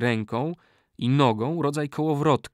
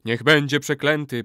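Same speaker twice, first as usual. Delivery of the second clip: louder, emphatic echo of a curse; chorus repeating; 3 semitones higher, 5 dB louder than usual. A narrator's reading. All warriors repeat the curse, loud chorus. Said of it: "powtórzyli wszyscy wojownicy".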